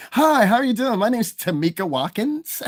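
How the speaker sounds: high, mock-polite introduction voice; put-on fitting-in voice.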